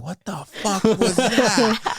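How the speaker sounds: high, slow, laughing disbelief; bewildered. Retold or imitated. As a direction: drawl it out in shocked amusement. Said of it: "I'm just like".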